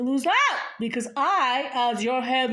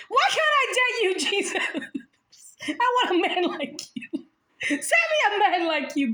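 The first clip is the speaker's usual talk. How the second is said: laughing plea; 9 semitones higher than usual. Pretend. Girl pleading with Jesus for a man. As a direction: beg him while laughing. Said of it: "I'm just like".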